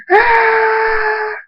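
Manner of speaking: very high, drawn-out scream; piercingly high held scream; long scream. A narrator's reading. Thunderstorm screams as his armor appears, long scream. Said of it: "and he screams".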